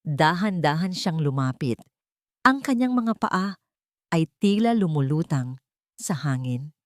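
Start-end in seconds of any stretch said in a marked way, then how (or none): none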